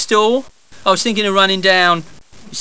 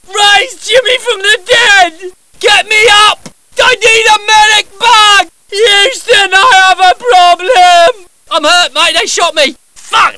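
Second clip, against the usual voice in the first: pained yelling for a medic, higher and louder; man down shout; 11 semitones higher, 7 dB louder, slower than usual. Acted. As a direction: cry out hurt on the battlefield. Wounded character calls for medic.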